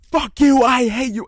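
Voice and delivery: high, loud imitated screaming; retold angry scream. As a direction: yell it as the furious friend did. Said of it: "and she was screaming".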